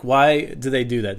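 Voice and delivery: ordinary talk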